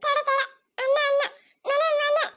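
put-on high-pitched, repetitive childish wailing; whining child cry. Upset little one pestering the grown-ups, whining high. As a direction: whine for it in a small high voice. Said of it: "ಅಳ್ಬಾರ್ದು, ಸುಮ್ನಿರಪ್ಪ".

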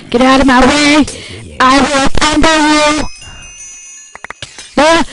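loud mock battle cry; battle yell. Real, not acted. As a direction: yell them out of the way.

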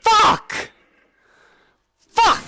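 high, loud startled shriek; real fright, screamed; scared scream. Real, not acted. Jump scare in horror game.

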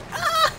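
high shriek; startled scream. Real, not acted. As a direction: scream in fright.

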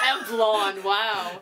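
laugh-cry the words. Real, not acted. Laughing repeat of boyfriend's answer.

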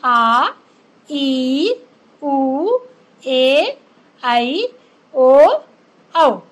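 each vowel आ ई उ ए ऐ ओ औ held long and clear; दीर्घ स्वर लंबे खींचकर; long vowels drawn out. Shown as a demonstration. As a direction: sound out आ ई उ ए ऐ ओ औ one by one, each stretched. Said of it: "ये दीर्घ स्वर के अंतर्गत आते हैं".

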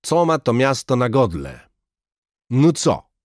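put-on high, loud Russian-accented shout; mighty shout. A narrator's reading. Major from the car step roaring at the crowd, loud accented shout. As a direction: roar the question at the crowd. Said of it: "krzyczał Malenkow potężnie".

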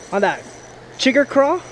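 usual speaking voice

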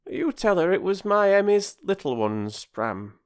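put-on high, homely old countrywoman; old village woman handing over a gift; kindly village woman. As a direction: speak as a warm-hearted neighbour.

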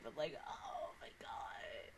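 breathless whispered gasp; barely voiced awe. Real, not acted. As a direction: hush it like a secret. Overwhelmed fan aside.

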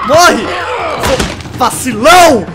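loud high angry shouts; furious yelling. Real, not acted. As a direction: scream insults at the enemy. Fighting enemy in game.

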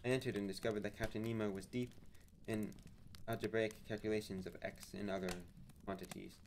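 ordinary delivery

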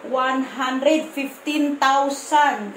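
counting the money out slowly, word by word. Real, not acted. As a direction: draw each number out as you count. (mabagal na pagbibilang)

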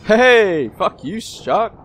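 loud, high whoop at the shark; triumphant shout. Real, not acted. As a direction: yell in triumph at the beaten shark.

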